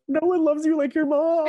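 high, loud mock wail; imitated crying. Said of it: "Liana immediately starts crying".

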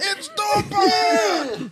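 loud, very high, drawn-out shout; henchman's yell. Acted. Game henchman alerting others to Batman, hammed-up shout. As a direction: bellow it high and slow, hammed up. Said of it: "henchman in an Arkham game who yells".

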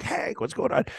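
high, unvoiced imitation; imitating Thomas on the phone, high and unvoiced; mock friend on FaceTime. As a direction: toss it off in a high voice.

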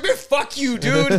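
hammed-up betrayed friend; mock angry curse. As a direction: spit it out like the joke betrayed you. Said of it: "Like, I was so angry".

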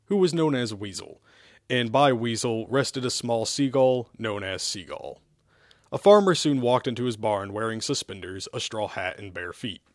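usual speaking voice

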